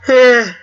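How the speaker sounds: big loud exasperated sigh; loud weary sigh. Real, not acted. Loud sigh between lines.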